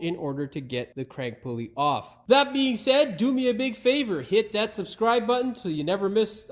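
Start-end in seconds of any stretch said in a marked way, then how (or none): 2.3-6.5 upbeat host read. Acted. Channel host pitching subscribe to viewers, upbeat presenter read.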